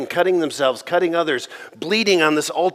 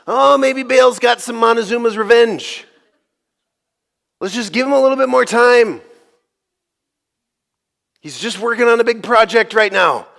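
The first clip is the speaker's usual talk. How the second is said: high, loud mock sympathy; Elijah being snarky; 9 semitones higher, 6 dB louder than usual. Prophet mocking rivals over their absent god, snarky. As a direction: jeer with fake excuses for their god. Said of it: "So Elijah's getting snarky".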